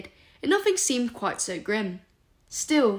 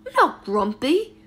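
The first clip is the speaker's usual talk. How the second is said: slow, louder indignant objection; objecting; 3 semitones higher, 7 dB louder, slower than usual. A narrator's reading. Remus objecting to Sirius calling him grumpy, slow and indignant. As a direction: insist you're not grumpy, offended. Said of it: "Remus objected".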